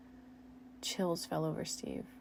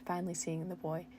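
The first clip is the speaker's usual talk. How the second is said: usual speaking voice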